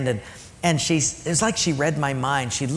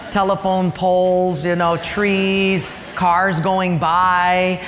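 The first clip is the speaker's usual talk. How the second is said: drawn-out list; 3 semitones higher, 4 dB louder, much slower than usual. Real, not acted. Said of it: "listing hugged things slowly".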